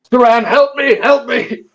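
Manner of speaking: high, desperate cries for help; calling for help. Acted. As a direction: call out high and urgent from the dream.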